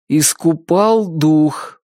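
slowly repeating «Искупал» with stress on «пал», still wrong; slow unsure repeat. Acted. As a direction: drag out «Искупал дух» uncertainly. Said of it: "повторил Чак". (медленно, неуверенно «Искупал дух»)

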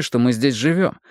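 ordinary delivery, nothing marked

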